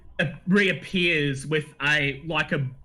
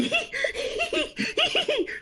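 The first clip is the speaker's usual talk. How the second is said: high giddy cackle while rocking; gleeful gnome cackle. Acted. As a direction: cackle rubbing his hands. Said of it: "rocks back and forth going".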